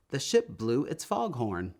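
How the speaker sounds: usual speaking voice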